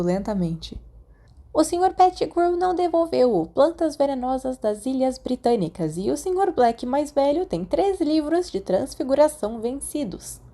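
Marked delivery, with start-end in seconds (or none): 1.5-10.4 put-on high, prim old librarian listing overdue books; fussy librarian, much higher voice; strict librarian. A narrator's reading. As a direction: peer over your glasses and list the culprits.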